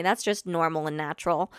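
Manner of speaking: usual speaking voice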